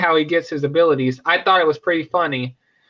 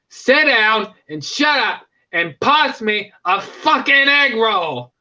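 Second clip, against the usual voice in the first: slow, raised mock Australian snarl; angry Kano; 4 semitones higher, slower than usual. Kano fed up at the dinner table, mock Australian. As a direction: bark it out in a put-on Aussie accent. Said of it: "And he says".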